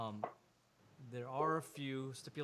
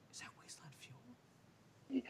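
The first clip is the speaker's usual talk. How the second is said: high, slow, hushed whisper; awed whisper; 13 semitones higher, 6 dB quieter, slower than usual. Acted. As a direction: breathe it like you've found treasure. Marvin spots the fuel, tempted.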